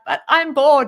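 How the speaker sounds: loud, high whine; whiny complaint. Retold or imitated. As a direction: complain like a bored kid. Complaining to husband.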